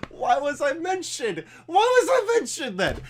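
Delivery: high-pitched, laughing disbelief; incredulous squeal breaking into laughter; laughing protest. Real, not acted. Laughing protest at being accused.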